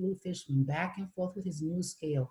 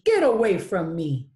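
angry dismissal; 9 dB louder than usual. Acted. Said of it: "cried Rainbow Fish".

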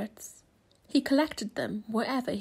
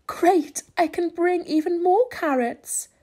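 high, bright grin in the voice; smiling; 7 semitones higher, 6 dB louder than usual. A narrator's reading. Rabbit delighted about bringing more carrots, smiling. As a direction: beam with delight. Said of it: "Rabbit said with a smile".

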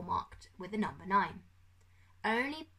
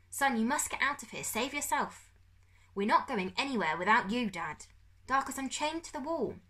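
raised, urgent voices; father and son talking; 5 semitones higher than usual. A narrator's reading. Father urging son to flee, higher tense voice. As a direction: plead with each other in the cell.

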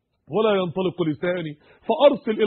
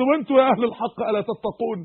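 ordinary talk, nothing marked

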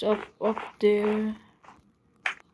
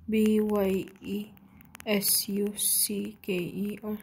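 slow, letter-by-letter spelling; 3 semitones lower, 6 dB quieter, slower than usual. Real, not acted. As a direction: spell it slowly, one letter at a time. Spelling out words for the other.

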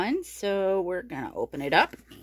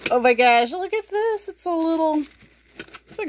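excited; 10 semitones higher, 4 dB louder than usual. Real, not acted. Excited at new box item.

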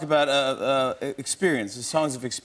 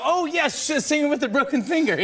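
sudden remembering; 14 semitones higher than usual. Retold or imitated. [name] suddenly remembers him.

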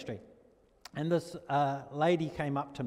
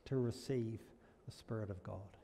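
quiet retold sorrow; 2 semitones lower, 10 dB quieter than usual. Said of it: "she said".

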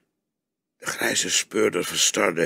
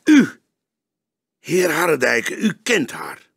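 sharp, much higher call on the first word; detective accusing the journalist, resolute; resolute accusation; 7 semitones higher, 6 dB louder than usual. A narrator's reading. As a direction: point him out firmly. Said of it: "strekte hij zijn rechterhand resoluut".

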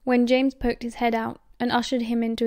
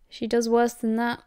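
ordinary delivery, nothing marked

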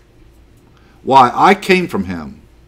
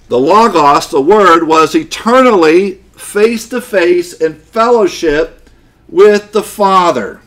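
loud, raised-pitch sermon delivery; forceful preaching; 6 semitones higher, 6 dB louder than usual. Real, not acted. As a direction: proclaim it to the congregation, weighting key words.

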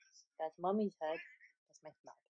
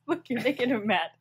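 usual speaking voice

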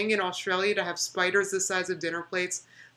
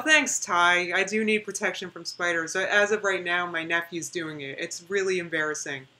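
ordinary talk, nothing marked

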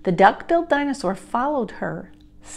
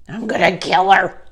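exasperated mutter; 5 dB louder than usual. A narrator's reading. Jack grumbling after Annie disappears, exasperated mutter. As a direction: mutter it in annoyance. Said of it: "Jack muttered".

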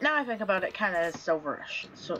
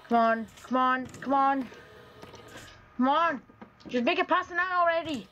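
tense urging; 5 semitones higher than usual. Real, not acted. Willing the night to end.

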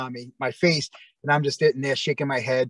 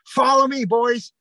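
much higher, louder call; rallying call; 9 semitones higher, 4 dB louder, slower than usual. Retold or imitated. Wrestler calls his partners to follow him out, pitched-up rally call. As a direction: summon the boys with a bright pitched-up command.

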